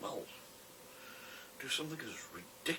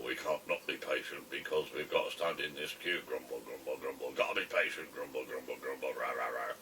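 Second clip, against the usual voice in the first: grumbling man; 5 semitones lower than usual. Retold or imitated. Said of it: "gentleman ... that was grumble".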